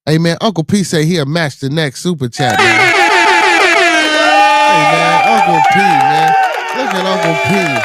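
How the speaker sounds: loud, high hype for Uncle P; shouting out Uncle P's super chat match; hyped shout. Real, not acted. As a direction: whip the chat into a frenzy.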